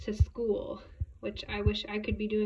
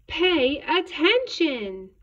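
loud, high and drawn out; slow raised teacher's voice; scolding teacher; 6 semitones higher, 7 dB louder, much slower than usual. A narrator's reading. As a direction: stretch each word to get a pupil's attention. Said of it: "David's teacher always said".

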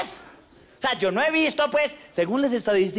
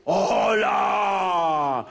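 Dracula voice; 3 semitones lower than usual. Acted. Dracula appears.

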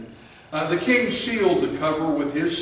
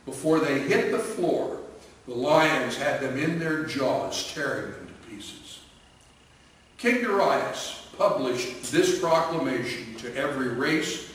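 plain reading voice

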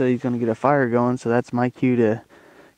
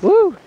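excited woo; 7 dB louder than usual. Real, not acted. Emerging into cold morning.

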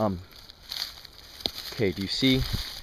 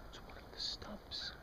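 whispering; 24 dB quieter, slower than usual. Pretend. Creeping up on a stump.

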